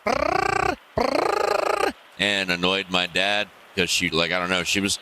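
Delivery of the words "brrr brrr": high squeaky raccoon chirr; raccoon trill. Retold or imitated. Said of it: "she was just sitting there like".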